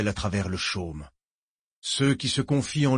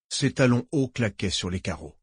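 ordinary delivery

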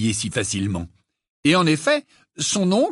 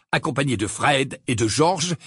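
usual speaking voice

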